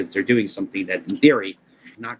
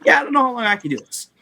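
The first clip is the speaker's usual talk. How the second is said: high, fast strained quote; mock high-pitched imitation, rushed out; 4 dB louder, faster than usual. Bandmate admitting he may not last, high rushed mock voice. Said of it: "[name] from M-Wave was like".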